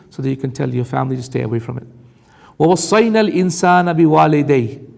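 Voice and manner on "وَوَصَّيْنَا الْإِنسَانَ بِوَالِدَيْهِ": fast, chanted Arabic recitation; تلاوة القرآن; Quran recitation. Acted. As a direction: recite the verse in tajweed.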